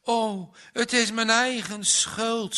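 high, loud cry of guilt; mother crying out her guilt, loud and high; anguished cry. Acted. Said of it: "schreeuwde ze".

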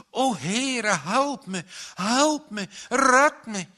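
loud, high pleading cry, rising to a scream; desperate prayer. Acted. Drowning boy cries out to God for help, frantic pleading scream. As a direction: beg for rescue with all your breath.

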